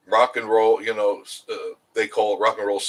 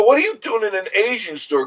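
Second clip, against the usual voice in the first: mock surprised question; 11 semitones higher, 5 dB louder than usual. Retold question to the elf.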